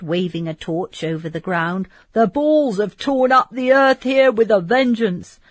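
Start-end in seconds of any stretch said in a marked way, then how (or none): 2.1-5.4 louder, higher rough soldier voice; loud soldier's exclamation. Acted. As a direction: boom it out like a battle-worn guard. Bertrand surveying the battleground.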